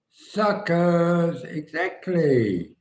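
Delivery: high, loud, drawn-out taunt; gleeful mock jeer. Son reacting to the video, mock gleeful jeer. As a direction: imitate a son crowing, stretching the words. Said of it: "and he laughed".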